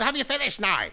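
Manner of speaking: loud, high mock Paisley bellow; imitating Paisley's booming demand; booming preacher. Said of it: "he says, he said".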